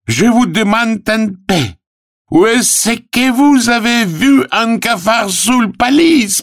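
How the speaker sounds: very high, loud pleading cries; desperate. Acted. Arthur protests and calls the police, high desperate cries. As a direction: wail high for help, desperate. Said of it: "Артур в отчаянии".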